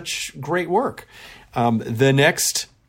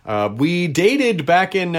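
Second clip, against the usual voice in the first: normal talking voice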